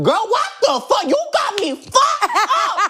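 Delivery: very high, slow outraged nanny squeal; hammed-up high outrage in the Doubtfire voice; Mrs Doubtfire shriek. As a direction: screech it like a scandalised Mrs Doubtfire. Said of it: "he was fucking howling".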